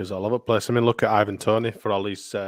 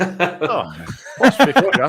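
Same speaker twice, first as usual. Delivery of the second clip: laughing mockery; 8 semitones higher, 6 dB louder, slower than usual. Real, not acted. Mocking the price gap.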